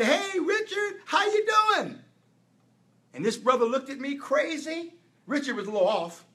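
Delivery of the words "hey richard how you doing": much higher bright hello; greeting a long-lost acquaintance, pitched way up; cheery greeting. Retold or imitated. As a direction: call out happily to an old friend. Friendly greeting to old acquaintance.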